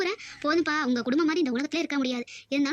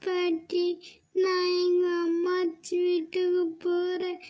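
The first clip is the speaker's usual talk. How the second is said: put-on slow, higher child's voice; a small kid sadly announcing she is leaving; little granddaughter apologizing to grandma, slow and sulky; sulky little girl; 3 semitones higher, much slower than usual. Little girl leaving after quarrel.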